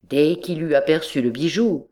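ordinary delivery, nothing marked

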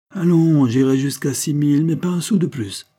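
high, loud bargainer's offer; insistent haggling. Acted. Buyer haggles with a reluctant middleman, loud and insistent. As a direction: push the offer up loudly, pitched high.

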